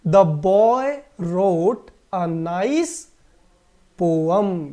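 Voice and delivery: very slow, word by word; slow model sentence. Shown as a demonstration. Teacher reads the example sentence to the class very slowly. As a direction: dictate it slowly. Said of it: "अब वाक्य देखिए".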